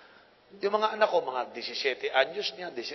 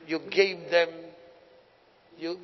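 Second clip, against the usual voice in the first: usual speaking voice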